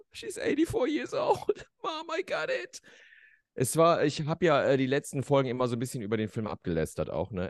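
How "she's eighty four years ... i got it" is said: high, slow, fake crying; mock tearful speech. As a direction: blubber through a tearful thank-you. Tearful Oscar speech parody. (hochgezogene, weinerliche Dankesrede)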